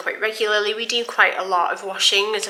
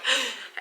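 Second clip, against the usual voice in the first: gasp for air; 5 semitones higher, 5 dB quieter than usual. Shown as a demonstration. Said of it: "I'm like".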